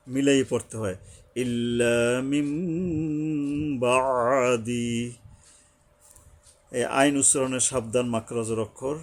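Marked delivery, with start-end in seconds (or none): 1.4-4.9 slow recitation, long humming মিম before বাদি; noon read as meem. Shown as a demonstration. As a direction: hold the nasal m in মিম, then draw out বাদি. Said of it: "নুনকে মিম ধরে পড়তে হবে". (মিম টেনে গুন্নাহ করে ধীরে বাদি)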